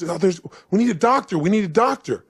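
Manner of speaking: high, loud, repeated plea; urgent call for help. Retold or imitated. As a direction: call out frantically for a doctor, acted. Costner calls for a doctor.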